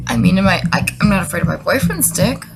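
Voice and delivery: usual speaking voice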